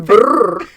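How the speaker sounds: loud rolled brrr sound; mock southern noise. Shown as a demonstration. Cohost showing a sound captions can't catch, loud brrr. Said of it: "If I go".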